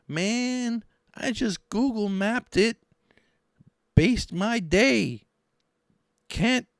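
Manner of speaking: very high, slow, stretched-out impression; Hugh Giordano. Vlogger doing a Hugh voice, high mocking impression. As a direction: pitch it way up and drag each word. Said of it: "this is my Hugh Giordano voice".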